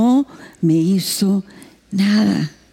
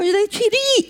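villager's word; 10 semitones higher, slower than usual. Retold or imitated. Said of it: "lo dijo tan rápido".

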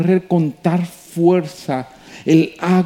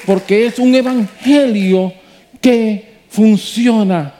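preaching at full force; 5 semitones higher than usual. Acted. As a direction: preach it to the rafters. Preaching climax.

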